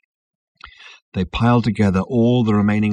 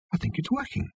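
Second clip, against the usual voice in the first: softer, awed murmur; quietly, as if not to jinx it; hushed; 8 semitones higher, 9 dB quieter than usual. A narrator's reading. Ford hushed, sensing success.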